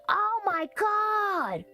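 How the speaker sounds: high, drawn-out oh my god; shocked realisation. Real, not acted. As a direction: stretch it high as it suddenly dawns on you. Sudden realisation about the play.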